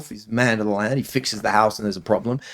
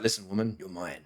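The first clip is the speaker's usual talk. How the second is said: much softer, dropping low and possessive; low dominant growl; 4 semitones lower, 12 dB quieter than usual. Retold or imitated. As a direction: say it low and close, claiming her. Quotes dominant man in bedroom.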